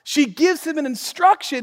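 ordinary delivery

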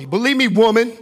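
loud, high-pitched outburst at the woman; exaggerated command. Preacher overplaying Jesus addressing the woman, exaggerated command. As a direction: hit "believe me, woman" loud and high, mock-bossy. Repeats it harshly, then objects.

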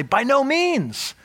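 "By no means" drawn out, pitched high and slow; emphatic denial. Retold or imitated. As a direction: spell out each word as a firm refusal. Paul's emphatic answer quoted.

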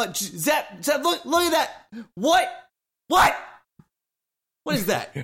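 high, loud startled cries; alarmed shouting. Acted. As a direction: yell in shock at the sight. Player voicing character alarmed at waves.

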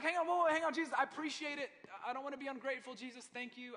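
high and loud, hurried protest; flustered objection. Retold or imitated. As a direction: stop Jesus in a panic. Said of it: "They were probably like".

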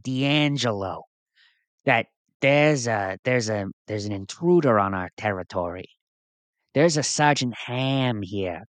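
usual speaking voice

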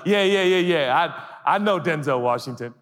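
loud, high eager bragging; boastful excitement. Acted. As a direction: brag about knowing a star. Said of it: "if somebody was like".

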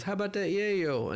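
high-pitched mock dealer's offer; imitated Rasta hustler. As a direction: make a pushy pitch in a put-on high voice. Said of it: "We had this Rasta guy come up".

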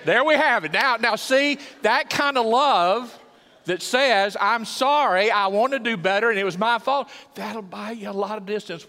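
loud, high, exultant proclamation; preaching at full force. Real, not acted. Preacher proclaiming what apologetic love says, full force. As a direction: ring it out over the congregation.

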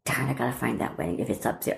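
low, hushed, quick thinking aloud; private thought. Retold or imitated. Daughter thinking while clearing attic, to herself. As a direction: murmur it to yourself, half unvoiced. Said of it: "in the back of my mind".

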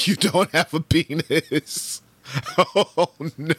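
words broken by high laughter; laughing while talking. Real, not acted. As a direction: laugh through the punchline. Laughing through the discovery.

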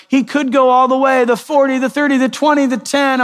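high-pitched, excited play-by-play call, imitated; football commentator. Watching football as the runner nears the end zone, imitated excited commentary. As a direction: call the run like a mock sportscaster, held high. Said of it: "like if you're watching football and you're like".

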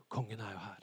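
low, very soft relieved murmur; relief. Acted. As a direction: let it out softly after a sigh. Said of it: "det blir lettelse". (lavmælt, lettet)

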